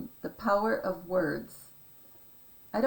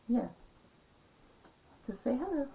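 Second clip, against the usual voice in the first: gentle pet-talk, raised pitch; coaxing; 5 semitones higher, faster than usual. Real, not acted. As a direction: sweet-talk them into saying hello. Coaxing someone hidden to greet.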